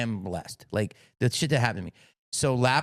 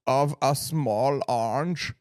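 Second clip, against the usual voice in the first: put-on German accent; much slower than usual. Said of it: "I'll try to do his accent".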